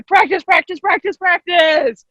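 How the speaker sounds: high, slowing excited chant; rallying cry. Pretend. Coach running around urging students to practice, rallying cry. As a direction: call it out as if running round the room. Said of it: "I will run and say".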